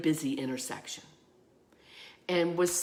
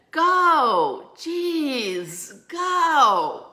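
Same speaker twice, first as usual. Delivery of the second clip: much higher, louder mock yell; yelled out a window; 8 semitones higher, 6 dB louder, much slower than usual. Driver behind yelling at her through his window, loud mock yell. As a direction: call it out of the car window. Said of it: "he leaned out his window and he said".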